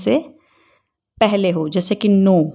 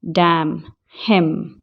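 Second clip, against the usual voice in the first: ordinary talk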